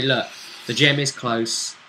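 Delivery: ordinary talk, nothing marked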